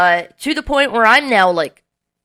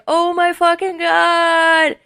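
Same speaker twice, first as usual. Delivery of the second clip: higher, slow, stunned oh my God; amazed gasp; 8 semitones higher, slower than usual. Retold or imitated. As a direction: drag it out in disbelief. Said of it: "Everyone's like".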